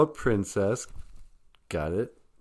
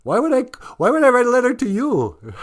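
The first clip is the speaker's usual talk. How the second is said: amused disbelief; 7 dB louder than usual. Real, not acted. Laughing at own slip.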